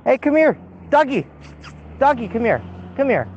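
high call to a dog. Real, not acted. Friend calls a runaway dog to come back, high coaxing call. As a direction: coax the dog over from a distance.

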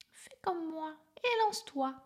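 high encouraging bird voice; a bird urging his friend to jump; parrot voice. A narrator's reading. Parrot urging the elephant to leap, high encouraging.

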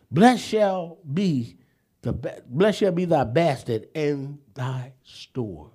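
slow, fading blessing recitation; solemn scripture reading. Acted. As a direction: intone the blessing like a benediction.

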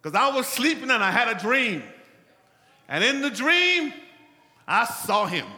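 loud, high testimony; preaching at full force. Acted. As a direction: proclaim it loudly. Preaching, loud dream claim.

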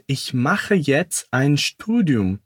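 slow, careful German for learners; slow and careful demo; slow model line. Shown as a demonstration. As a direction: spell it out slowly for the class. Teacher models German line slowly.

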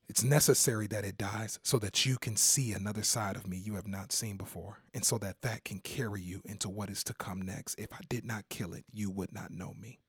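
soft, low, almost whispered preaching; quiet intimate preaching; hushed sermon voice. Acted. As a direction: draw them in with a hushed voice. Preacher speaks Jesus' words softly.

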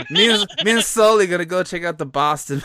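loud, high mock Boston local; broad Boston buddy talk; Boston accent. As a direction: play a hammed-up Boston guy. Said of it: "a wicked thick Boston accent".

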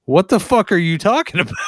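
fast, high, baffled yell; hammed-up confused outburst, pitched high; confused shout. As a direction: blurt it out bewildered. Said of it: "confused why he's got a gun in his face going".

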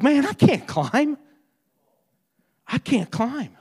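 mock-tearful protest, restrained; retold weeping. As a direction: imitate a grieving friend, lightly tearful. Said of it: "he's crying".

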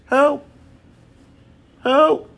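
high-pitched little plea; mock cry for help. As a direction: call "help" high, without shouting.

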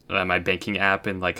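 ordinary delivery

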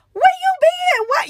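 sudden high squeal of surprise; surprised greeting. Retold or imitated. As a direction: blurt it out, shocked to see him. Greeting her ex at the event.

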